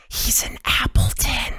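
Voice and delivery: gossipy stage whisper; hushed, voiceless aside; conspiratorial whisper. Acted. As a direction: lean in and whisper the secret. Amanda leans in to tell secret.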